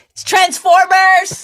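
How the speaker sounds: hammed-up overexcited man at the movies; mock excited yell. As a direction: ham up a husband thrilled by a trailer. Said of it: "He's like".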